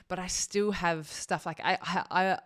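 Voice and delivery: ordinary delivery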